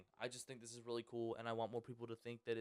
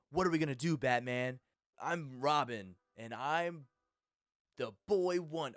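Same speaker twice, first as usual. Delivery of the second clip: put-on louder, eager young-sidekick voice; Robin; 7 dB louder than usual. Shown as a demonstration. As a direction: pitch it as an audition for the boy sidekick. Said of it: "I could do the voice for Robin".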